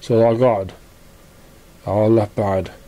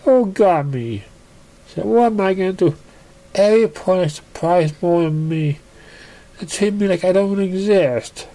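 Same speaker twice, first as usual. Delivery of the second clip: Pinkie despairing; 8 semitones higher than usual. A narrator's reading. Pinkie despairing on her bed.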